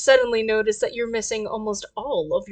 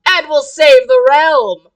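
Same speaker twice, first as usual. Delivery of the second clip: heroic adventurer; 2 semitones higher, 7 dB louder than usual. Acted.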